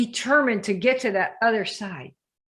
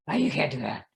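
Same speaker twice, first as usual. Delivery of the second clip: lower doubting voice; 6 semitones lower, 3 dB quieter than usual. Retold or imitated. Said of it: "The you that can't do jack".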